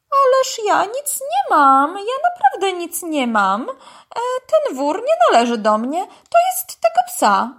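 high, whining desperate denial; panicked pleading. A narrator's reading. Arrested python blames the dog, high and panicky. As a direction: protest innocence in a squealing, pleading voice.

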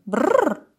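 shuddering brr. A narrator's reading. Dog imagines prison with the python, shuddering. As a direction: roll out a "brrr" of dread.

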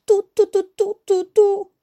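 high, loud tooting; spoken whistle toots, pitched very high; imitated whistle blasts. A narrator's reading. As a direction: toot like an alarm whistle. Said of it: "zaczęła gwizdać".

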